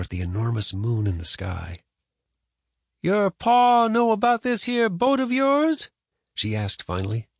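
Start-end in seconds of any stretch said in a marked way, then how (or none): 3.0-5.9 slow, high-pitched grandma question. Acted. Grandma asking boy whether Pa knows, suspicious.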